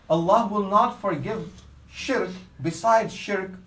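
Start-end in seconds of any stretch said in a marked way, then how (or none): none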